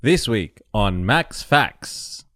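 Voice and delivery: slow, low show announcer. Acted.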